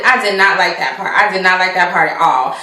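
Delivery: ordinary talk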